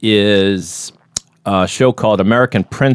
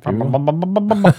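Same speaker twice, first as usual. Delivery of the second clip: puzzled echo; 8 semitones higher, much slower than usual. Real, not acted. Repeating unfamiliar name.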